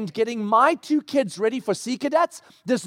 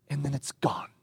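near-whisper, low and soft; hushed; 7 semitones lower, 9 dB quieter than usual. Real, not acted. Preacher telling how joy disappears, hushed. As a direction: let it vanish into a hush. The joy bubble vanishes in smoke.

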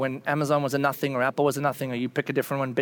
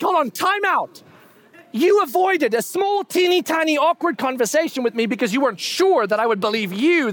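incredulous outrage; 10 semitones higher, 3 dB louder than usual. Retold or imitated. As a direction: blow up at the friend, pitched way up. Confronting friend who hid the tip.

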